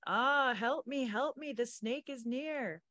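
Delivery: high, slow pained moan. Acted. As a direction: groan out the plea for help. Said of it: "Lola Mays moaned".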